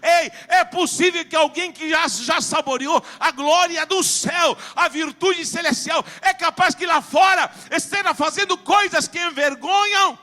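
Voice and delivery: loud, high shouted sermon; full-force preaching. Real, not acted. Preacher challenging those who tasted heaven's glory, full-force shout. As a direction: thunder it out at the congregation.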